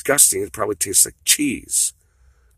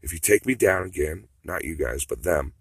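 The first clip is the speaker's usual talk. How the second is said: ordinary talk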